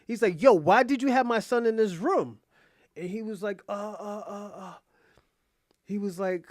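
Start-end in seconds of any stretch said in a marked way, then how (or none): 0.4-2.4 higher, louder retold outrage; angry confrontation. Acts out father's angry confrontation. 3.7-4.7 softer, halting mock stammer; flustered stammer. Voices butler lost for words.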